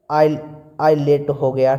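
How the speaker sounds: normal talking voice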